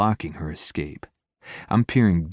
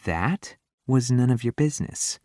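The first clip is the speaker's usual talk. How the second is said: higher, teasing smirk; 5 semitones higher than usual. A narrator's reading. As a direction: tease him with a grin. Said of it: "Her smirk widens to a full grin".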